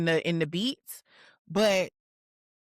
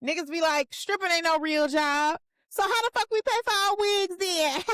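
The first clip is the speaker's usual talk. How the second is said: high-pitched, playful imitation of a rapper's ad-lib; 11 semitones higher, 3 dB louder than usual. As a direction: quote it pitched way up, building to the punchline. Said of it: "There's a part where she says".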